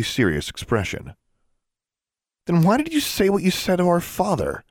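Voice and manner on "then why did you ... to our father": high, sharp accusation; accusing. A narrator's reading. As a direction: demand an answer. Accusing question.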